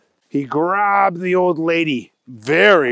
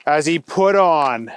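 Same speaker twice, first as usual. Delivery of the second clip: ordinary delivery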